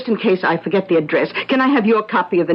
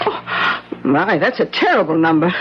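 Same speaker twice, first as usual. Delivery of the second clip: ordinary talk, nothing marked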